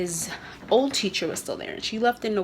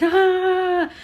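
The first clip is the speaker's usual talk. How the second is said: a sudden high whiny "ah"; mock kid's upset cry; imitated whine; 11 semitones higher, 6 dB louder than usual. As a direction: mimic the toddler's frustrated whine. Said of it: "and he's like".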